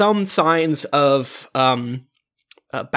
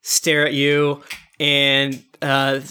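ordinary talk, nothing marked

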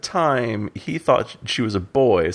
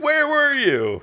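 high-pitched mock Arya; squeaky little-girl Arya; voicing Arya; much slower than usual. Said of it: "she's like".

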